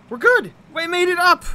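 loud high triumphant cheer; excited shout. Real, not acted. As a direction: cheer the win. Made it up.

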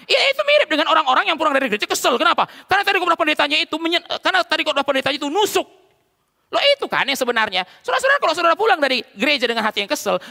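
loud, high-pitched impassioned sermon; full-force preaching. Real, not acted. Pastor confronting churchgoers who go home angry, full-force preaching.

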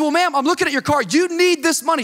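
high, insistent pleading; worked-up, exasperated insistence; arguing. Retold or imitated. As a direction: press her hard to accept. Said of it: "Now I'm arguing with this woman".

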